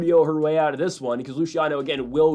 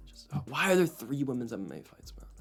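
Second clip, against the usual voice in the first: muttered aside; 8 dB quieter than usual. Real, not acted. Complaint muttered under breath.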